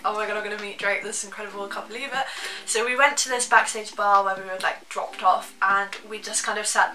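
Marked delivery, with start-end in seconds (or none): 0.0-2.3 hushed, hurried excited disbelief; soft, rushed mock freak-out; retold freak-out. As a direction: replay the freak-out quickly, under your breath. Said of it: "I remember just freaking out".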